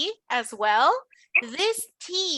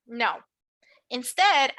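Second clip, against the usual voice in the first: normal talking voice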